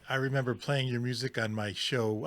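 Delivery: usual speaking voice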